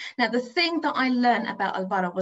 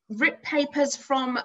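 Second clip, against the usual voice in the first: ordinary talk, nothing marked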